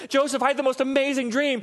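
high, hammed-up movie gush; breathlessly thrilled mock Mary; gushing excitement. Preacher spoofs Mary telling Joseph her dream, gushing excitement. Acting a gushing Hallmark scene.

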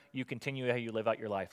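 usual speaking voice

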